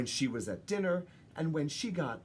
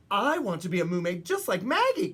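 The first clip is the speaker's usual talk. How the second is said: calling out; 4 semitones higher, 6 dB louder than usual. Acted. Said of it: "one of the dolphins called out".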